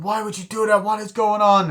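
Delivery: high, loud mock distress; tearful daughter. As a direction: act out an upset child pleading for answers. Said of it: "the daughter is just there crying".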